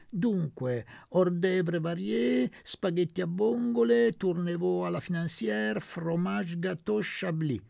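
hammed-up fancy waiter reciting the menu; mock-posh waiter. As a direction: show off like a grand French waiter. Waiter mocks with fancy French menu.

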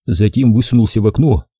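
ordinary delivery, nothing marked

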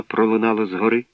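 ordinary delivery

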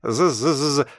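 high, loud fly buzz; high-pitched imitated insect zzz. A narrator's reading. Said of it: "Шпилевский зажужжал, как муха".

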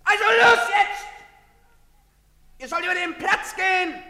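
a soldier bellowing at the crowd; barked orders. Acted. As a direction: yell them across the square. Soldier driving people across square.

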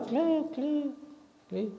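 high clink clink of the boat; a thin squeaky clink like the hull; imitated clinking noise. Preacher imitating the boat's clinking to the church, high squeaks.

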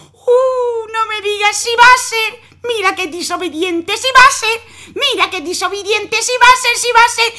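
high, loud wailing mother; mother lamenting her disobedient son. Acted.

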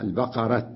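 البقرة ending in a clear t; pausal taa. Shown as a demonstration. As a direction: stop on البقرة with a t. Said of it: "وقف عليها بالتاء". (البقرة بالتاء عند الوقف)

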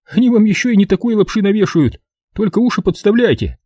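a wiseacre spinning his theory; cocky know-it-all soldier. A narrator's reading. Evans voiced by narrator.

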